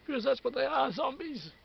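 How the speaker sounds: loud exasperated insistence; exasperated shout. Real, not acted. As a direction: burst out, fed up. Insisting they are zombies.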